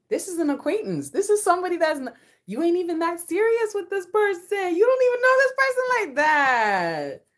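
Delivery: high, rising, loud objection; mock indignant protest. Partner objecting to a mere acquaintance, mock indignant. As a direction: protest in an exaggerated high wail.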